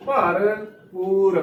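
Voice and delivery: ਭਰ ਪੂਰ slow with a clear p in ਪੂਰ; correct pronunciation. Shown as a demonstration. Teacher corrects the congregation, slow with a clear p. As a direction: say bhar poor slowly, pressing the p. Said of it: "ਪੱਪੇ ਨੂੰ ਦਲੈਂਕੜ ਦੇ ਨਾਲ ਹੀ ਉਚਾਰਨ ਕਰਨਾ".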